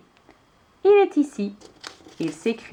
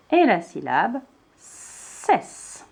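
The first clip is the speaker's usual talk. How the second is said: slow, deliberate "cesse"; slow syllable stress; much slower than usual. Shown as a demonstration. Teacher breaking princesse into syllables, slowly. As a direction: lay out "cesse" slowly for a child. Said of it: "Pour écrire le mot princesse".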